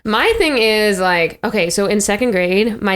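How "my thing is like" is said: usual speaking voice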